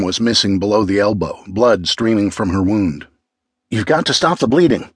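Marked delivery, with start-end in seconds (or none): 3.7-4.9 higher, understated urgent yell. A narrator's reading. Grace to girl with a bleeding friend, urgent raised voice. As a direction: urge it firmly, pitched up. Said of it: "Grace yelled".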